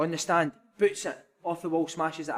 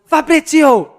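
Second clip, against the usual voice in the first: high, loud mock summons; hammed-up manager calling his assistant; ranting manager; 11 semitones higher, 14 dB louder, much slower than usual. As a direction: bellow the name like a manager gone berserk. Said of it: "then he goes".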